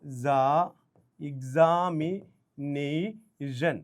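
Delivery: "examination" broken into slow, stretched syllables. Shown as a demonstration. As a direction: sound out "ഇഗ്സാ-, examination" piece by piece. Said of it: "ഇങ്ങനെ എഴുതുന്നത്".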